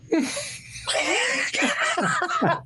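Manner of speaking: a high-pitched, strangled laugh with a sob in it; high helpless laugh. Retold or imitated. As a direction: laugh until it squeaks. Griffey's face after the joke.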